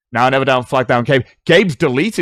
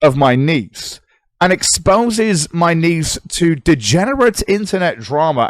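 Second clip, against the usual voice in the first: slow, higher mock-serious voice; mocking read-out; 6 semitones higher, 3 dB louder, slower than usual. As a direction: read the charge aloud as if it were absurd. Mock-reading accusations against himself.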